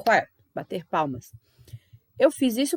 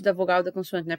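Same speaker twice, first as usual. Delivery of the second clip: usual speaking voice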